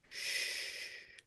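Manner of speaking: a whispered hush from the voice in his head; inner voice hushing. Retold or imitated. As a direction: hush yourself, barely audible. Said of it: "this voice in my head went".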